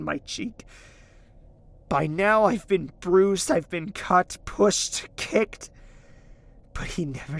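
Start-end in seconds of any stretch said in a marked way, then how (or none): 1.9-5.7 crying. Acted. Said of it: "You're crying now?".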